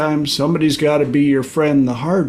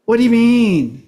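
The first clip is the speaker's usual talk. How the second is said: barista voice; 7 semitones higher, 3 dB louder than usual. Retold or imitated. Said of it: "She's like".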